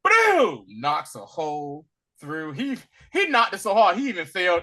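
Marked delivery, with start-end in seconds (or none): 0.0-0.6 loud, much higher boom; mock boom. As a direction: belt the impact. Sound of smashing through wall.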